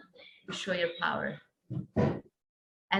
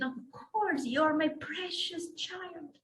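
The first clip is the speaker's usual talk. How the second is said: a mother's voice, higher, softer and slow; tender mother; 8 semitones higher, 7 dB quieter, slower than usual. Acted. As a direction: soothe your dear child, softly and slowly. Divine Mother comforting her daughter.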